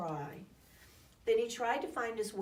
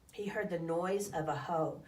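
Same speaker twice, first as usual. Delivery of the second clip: ordinary delivery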